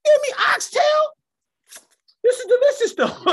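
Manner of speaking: very high, loud squeal of delight; amazed laughing squeal. Retold or imitated. Paul told the steak was oxtail, high laughing squeal.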